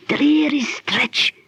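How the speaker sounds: slow hushed whisper of the code words; secret signal phrase. Acted. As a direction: breathe the signal words slowly. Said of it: "that will be the signal".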